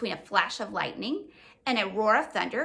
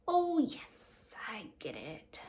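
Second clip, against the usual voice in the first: confused; 6 dB quieter, much slower than usual. Acted. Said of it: "with a confused look".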